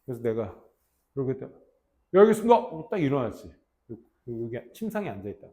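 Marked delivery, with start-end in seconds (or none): 2.1-2.5 recruit's yes sir. Retold or imitated. Recruit answers senior.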